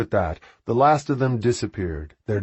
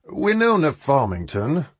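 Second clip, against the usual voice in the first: louder voice; 6 semitones higher, 3 dB louder than usual. A narrator's reading. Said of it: "Freddie said in a louder voice".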